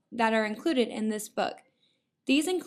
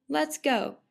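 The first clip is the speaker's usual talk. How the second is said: ordinary delivery, nothing marked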